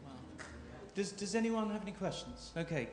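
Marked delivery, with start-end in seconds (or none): none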